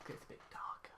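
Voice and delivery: voiceless hush; whispering. Acted. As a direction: whisper it in the dark. Darkening room for story.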